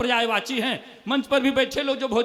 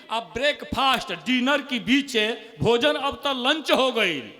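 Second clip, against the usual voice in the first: usual speaking voice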